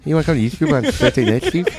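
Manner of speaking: quick lisping cartoon invitation; hammed-up slushy sloth lisp; Sid the Sloth. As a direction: lisp it through a laugh. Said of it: "the lisp crept into our normal conversation".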